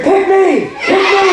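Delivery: high-pitched demo scream of eagerness; screaming eagerly. Shown as a demonstration. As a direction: shout it like you're begging to be chosen. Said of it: "they gotta be screaming".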